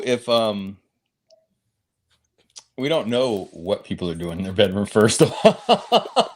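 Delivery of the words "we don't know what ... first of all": amused. Real, not acted. Joking about a couple's bedroom.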